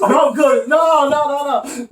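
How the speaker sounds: high, loud protest; laughing denial. Real, not acted. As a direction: brush off the accusation, laughing. Denying with laughter.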